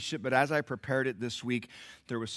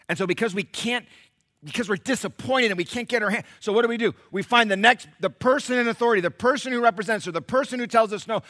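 loud, high, driving pulpit delivery; fervent sermon drive; full-force preaching; 11 semitones higher, 9 dB louder than usual. Acted. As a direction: push the point with intensity.

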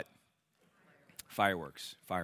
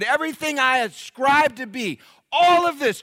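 much higher, much louder, building preaching; impassioned Paul; 12 dB louder than usual. Acted. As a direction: build Paul's list to a ringing peak. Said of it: "Paul said".

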